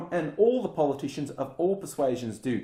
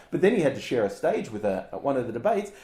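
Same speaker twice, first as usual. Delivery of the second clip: ordinary talk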